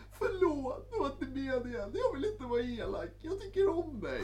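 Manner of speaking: high, whiny mock apology; pleading girlfriend voice. Mimicking the girlfriend calling back to apologise, high and whiny. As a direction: squeak out a sugary sorry. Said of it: "Ringer det igen".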